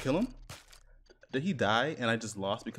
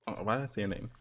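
normal talking voice

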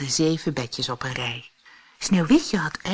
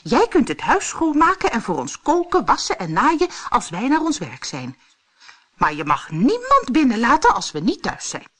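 high, raised character voice; higher-pitched storybook dwarf; instructing dwarfs; 7 semitones higher, 4 dB louder than usual. A narrator's reading. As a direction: lay down the house rules as a little dwarf.

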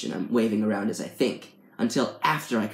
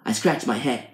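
plain reading voice

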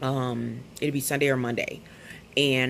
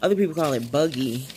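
ordinary talk

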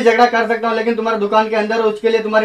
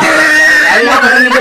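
high, loud, stretched-out cry of annoyance; exasperated shout; 6 semitones higher, 6 dB louder, much slower than usual. Acted. Customer shouting as the barber holds him back, exasperated. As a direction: yell it long, fed up.

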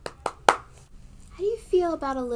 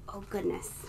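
whisper; 2 semitones lower, 8 dB quieter than usual. Acted. Reacting to slammed door.

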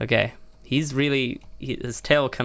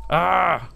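alarmed cry; 6 semitones higher, 4 dB louder than usual. Real, not acted. Pets attacking everything.

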